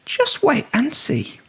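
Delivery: lightly high mouse. A narrator's reading. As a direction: promise it slyly. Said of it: "then said".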